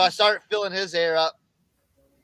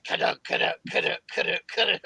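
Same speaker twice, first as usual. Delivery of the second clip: clunky rhythmic kaduk of a bad wheel; wheel thumping; faster than usual. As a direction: imitate the wheel knocking on the road. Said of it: "my wheel's like".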